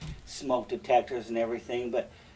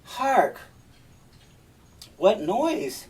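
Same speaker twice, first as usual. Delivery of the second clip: Iago feigning alarm; 8 semitones higher, much slower than usual. Acted. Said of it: "And Iago's like".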